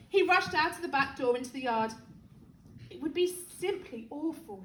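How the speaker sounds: ordinary delivery, nothing marked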